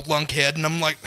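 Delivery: usual speaking voice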